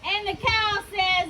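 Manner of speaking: usual speaking voice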